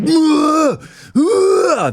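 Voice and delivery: gym grunt. Retold or imitated. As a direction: strain like lifting heavy weight. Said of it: "a guy or two just".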